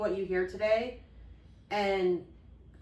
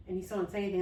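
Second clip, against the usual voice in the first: normal talking voice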